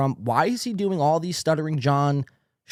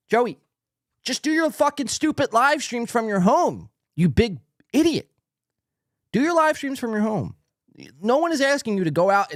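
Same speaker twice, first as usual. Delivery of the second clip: exasperated yelling; 7 semitones higher than usual. Real, not acted. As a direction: yell it at him in frustration. Yelling advice at Joey.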